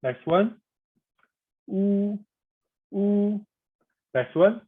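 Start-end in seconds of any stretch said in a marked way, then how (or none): 1.7-3.4 "wu" held high and level; flat tone. Shown as a demonstration. Teacher leading practice on the next vowel, flat tone. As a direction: keep "wu" on one high note. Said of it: "would be the flat tone".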